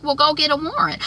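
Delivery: high-pitched dare; Veronica's challenge. Retold or imitated. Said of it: "That's Veronica telling him".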